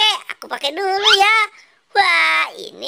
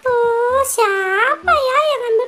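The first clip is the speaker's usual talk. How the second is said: a much higher, fretful little voice; annoyed high character; 8 semitones higher than usual. Acted. Toy character annoyed his dustpan is gone, high grumbling voice.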